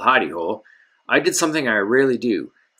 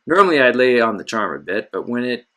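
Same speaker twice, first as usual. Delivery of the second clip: ordinary delivery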